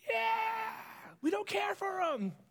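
high, excited jeer from the crowd; cheering crowd. Acted. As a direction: cheer like a gloating mob.

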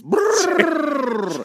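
shivering. Pretend. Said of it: "I got the chills".

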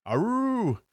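high loud wolf howl Aroo. Acted. Howl grows louder, closer.

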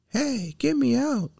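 very high-pitched puppet cry; calling for help. A narrator's reading. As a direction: call out from inside a locked cage. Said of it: "called Pinocchio".

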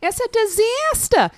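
high, slow, loud Long Island accent. Shown as a demonstration. Guest showing friends her one American word, Long Island accent. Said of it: "I can do one word".

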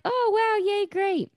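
very high, sing-song mock delight; put-on cheering. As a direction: imitate girls cooing approval. Retelling girls' reaction to the tip.

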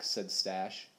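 plain reading voice

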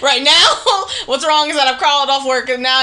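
loud, high mock wail through laughter; half-laughing tearful blurt; retold crying. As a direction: wail it, hammed-up, while laughing. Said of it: "now I'm crying about that".

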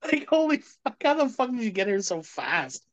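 high, astonished holy fuck; joking amazement at a friend's quick arrival; amazed. Retold or imitated. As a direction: marvel at the speed.

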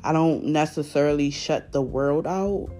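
usual speaking voice

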